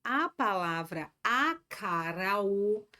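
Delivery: very slow, each syllable laid out; slow emphasis. Shown as a demonstration. Teacher to live students, slow and deliberate. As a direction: spell it out slowly for the class. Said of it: "Presta atenção nesse detalhe".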